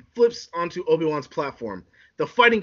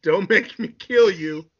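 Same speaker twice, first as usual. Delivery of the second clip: slow, higher-pitched villain threat; threatening Anakin; 5 semitones higher, 3 dB louder, slower than usual. Acted. Anakin threatens his old master, slow and high. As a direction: draw the threat out slowly.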